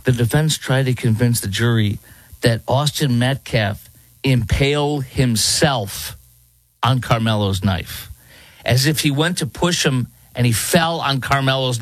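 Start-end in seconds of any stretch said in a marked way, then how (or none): none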